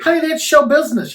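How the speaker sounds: high, loud, sassy honey; sassy drag queen. Acted. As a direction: toss it off like a diva.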